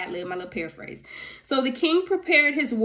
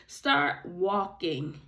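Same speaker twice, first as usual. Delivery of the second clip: "Start walking" drawn out slowly and low; firm command; 4 semitones lower, 4 dB quieter, much slower than usual. Acted. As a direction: say "Start walking" slowly, each word weighted. God's command, voiced in paraphrase.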